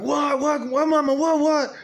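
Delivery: high, rushed, panicked questions; alarmed what. Retold or imitated. Son runs in to his shouting mother, alarmed and high. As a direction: burst in asking what happened.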